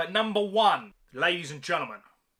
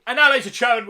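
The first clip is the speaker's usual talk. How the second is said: announcer hype; 2 semitones higher, 8 dB louder, much faster than usual. Acted. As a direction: announce it like a countdown show host. Countdown host announcing the ranking.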